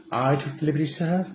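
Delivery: a hint of a prim butler; Alfred. Alfred answering Bruce, lightly voiced butler.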